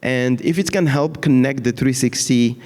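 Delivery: normal talking voice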